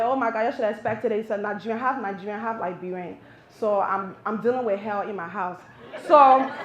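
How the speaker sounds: usual speaking voice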